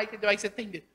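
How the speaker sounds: ordinary talk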